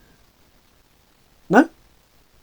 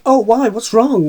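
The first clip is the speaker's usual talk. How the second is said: mock concern; 7 semitones higher, 5 dB louder, slower than usual. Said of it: "then everyone's like".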